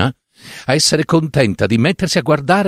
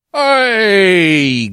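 sharp raised call to break up the scuffle; 7 semitones higher than usual. Acted. The author calling out to his squabbling characters, a sharp raised call. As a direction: call out to stop them.

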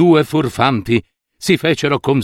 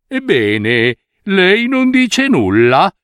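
sly weaver exclaiming; 6 semitones higher, slower than usual. A narrator's reading. Swindler weaver pressing the silent minister, high and slow. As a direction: prod him with a mock-innocent question. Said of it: "esclamò uno dei tessitori".

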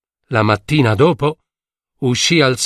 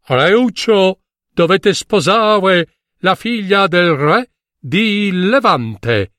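higher, slow royal command; king's voice; 6 semitones higher, slower than usual. A narrator's reading. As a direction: speak as the king ordering. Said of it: "gli aveva detto". (voce del re)

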